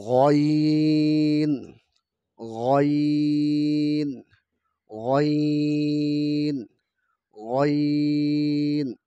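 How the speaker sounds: গইন drawn out slow from the back of the throat; ghain from the throat's end. Shown as a demonstration. Teacher demonstrating ghain for children. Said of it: "হলকের শেষ থেকে উচ্চারণ করতে হবে".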